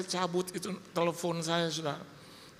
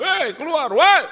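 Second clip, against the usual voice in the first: high, very loud summons; imitated angry shout; 13 semitones higher, 13 dB louder, slower than usual. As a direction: yell like collectors ordering someone out. Collectors order him to come out. (bentakan penagih menyuruh keluar)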